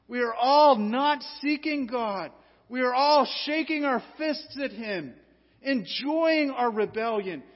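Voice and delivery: loud, driving pulpit delivery; forceful preaching. Acted. Preacher rousing the congregation with loud force.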